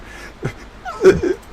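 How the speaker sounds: a high, breathless burst of laughter; real delighted laugh. Real, not acted. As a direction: squeal with delight at the joke. Delighted by Carl's description.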